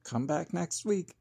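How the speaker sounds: plain reading voice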